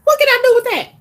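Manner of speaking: high squeaky scoff; mocking disbelief. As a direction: sneer at it in a shrill high voice. Mocking a man's offer.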